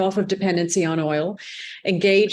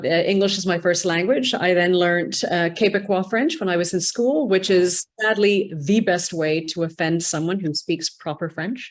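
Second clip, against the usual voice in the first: ordinary talk, nothing marked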